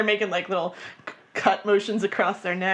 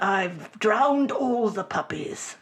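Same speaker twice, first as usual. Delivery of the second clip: slow, deadpan British menace; mock brooding Heathcliff. Host playing Heathcliff with bad British accent, slow. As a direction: drag it out like a gloomy Heathcliff. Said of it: "I'm the new Heathcliff".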